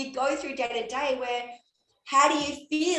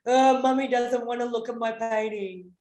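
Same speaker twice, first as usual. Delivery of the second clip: put-on disappointed child; 4 dB louder than usual. Disappointed child in acted scene.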